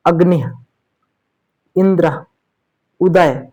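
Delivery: अग्नि, इंद्र, उदय with short vowels, each word apart; short, quick vowels. Shown as a demonstration. Teacher giving examples अग्नि, इंद्र, उदय with short, quick vowels. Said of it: "जल्दी बोल रहे हैं".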